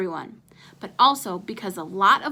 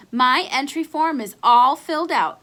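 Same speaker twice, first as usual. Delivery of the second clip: called out; 4 semitones higher, 7 dB louder than usual. Acted. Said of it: "he called".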